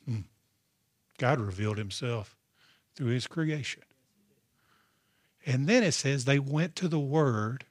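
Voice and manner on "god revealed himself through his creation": ordinary talk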